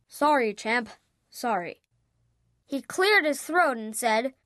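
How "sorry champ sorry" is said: apologetic dad. A narrator's reading.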